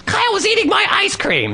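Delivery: put-on high whiny child's complaint; imitating kid. Boy telling his mom about the dog, high whiny voice. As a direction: whine it up high like a little boy.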